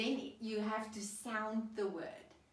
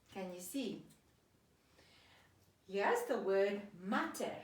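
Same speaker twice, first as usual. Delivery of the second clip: ordinary talk, nothing marked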